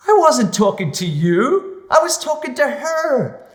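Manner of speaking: slow, high, loud put-down; imitated director slowly setting someone straight; incredulous correction. Director corrects the eager extra.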